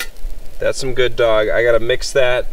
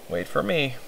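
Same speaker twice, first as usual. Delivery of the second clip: much softer, almost under breath; quiet; 3 semitones higher, 11 dB quieter than usual. Real, not acted. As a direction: say it softly. Quietly before eating.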